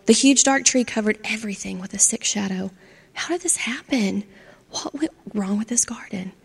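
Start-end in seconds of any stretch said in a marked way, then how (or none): 3.1-6.3 soft, unvoiced whisper; despairing whisper. Charlie despairing at his ruined garden, whispering. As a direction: breathe the question out.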